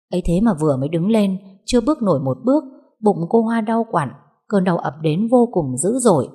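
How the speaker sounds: plain reading voice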